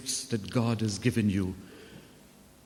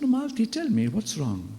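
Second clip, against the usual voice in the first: gently insisting; 9 semitones higher than usual. Retold or imitated. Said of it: "And I said".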